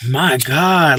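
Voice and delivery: usual speaking voice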